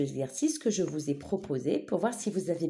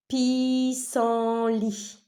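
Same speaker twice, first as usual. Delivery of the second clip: "Pissenlit" in long, raised, separate syllables; 5 semitones higher, 4 dB louder, much slower than usual. Shown as a demonstration. Teacher repeating the word for pupils, syllable by syllable. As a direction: stretch out pis, sen and lit.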